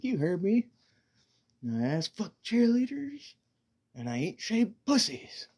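high, loud, proud declaration; Nate's loud proud boast. Retold or imitated. Nate repeats his crude boast at the mechanic shop, loud and high. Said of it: "He's like".

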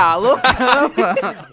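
loud laughing exclamation. Retold or imitated. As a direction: laugh into the words. Retold reaction, laughing.

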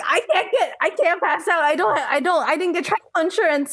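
high, stumbling mock panic; acted freaking out. Teller relives fear over missing travel insurance, comic panic. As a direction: trip over repeated words in comic panic. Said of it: "I'm, like, freaking out".

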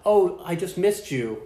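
high, slow sugary fake apology; mock sweet excuse. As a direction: coo it like a fake girlfriend. Voicing scammer's fake excuse.

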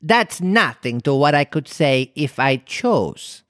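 slow, self-satisfied character voice; pleased, drawn-out boast; pleased Duchess. A narrator's reading. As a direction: draw it out, pleased with yourself. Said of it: "replied in a pleased tone".

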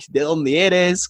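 slow, careful question to a foreigner's ear; speaking slowly. Retold or imitated. To the taxi driver, a slow careful question. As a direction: spell it out slowly so the driver follows. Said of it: "bien lento".

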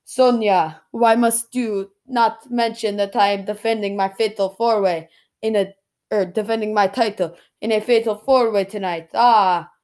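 amateur imitation Nigerian accent; wrestler's protest in a put-on Nigerian accent; attempted Nigerian accent. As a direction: have a shaky go at a Nigerian accent. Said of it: "can't do a Nigerian accent, but I'm gonna try".

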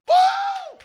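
very high, loud praise whoop. Real, not acted. Preacher rousing the congregation, high whoop. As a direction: let out a piercing whoop.

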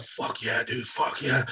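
soft, half-whispered mock thrill; mock hushed excitement. Viewer hushed at the screen, mock thrill. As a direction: breathe it under your breath. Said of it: "And you're like".

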